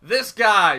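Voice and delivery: ordinary talk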